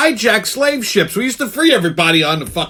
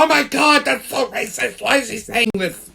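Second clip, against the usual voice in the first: whiny offended objector, a touch softer; mock outrage; 3 semitones higher, 3 dB quieter than usual. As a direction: play the shocked listener, lightly mocking. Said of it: "And I know people go".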